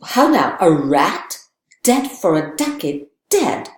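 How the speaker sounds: stab the words out as he thrusts; Hamlet's wild cry. Retold or imitated. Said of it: "Hamlet goes".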